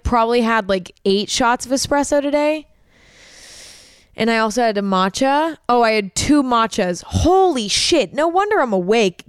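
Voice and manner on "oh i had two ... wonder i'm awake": shocked realization. Real, not acted. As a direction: react in disbelief at yourself. Realizing how much caffeine.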